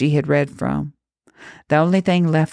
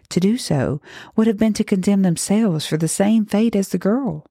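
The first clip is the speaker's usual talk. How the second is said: ordinary delivery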